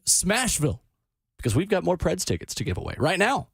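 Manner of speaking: upbeat radio giveaway pitch; radio contest promo. Acted. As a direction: hype the ticket contest.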